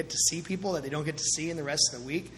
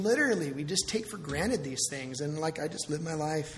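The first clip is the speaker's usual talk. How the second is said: normal talking voice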